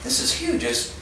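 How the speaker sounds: high, breathy, frightened protest; acted panic. Geologist warning colleague of a huntsman, panicky. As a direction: play the scared newcomer, voice pitched high.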